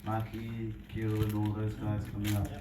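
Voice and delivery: usual speaking voice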